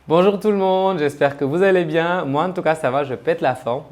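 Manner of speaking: bright channel-opening energy; energetic intro. Shown as a demonstration. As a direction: greet the viewers full of pep. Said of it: "Là j'y arrive".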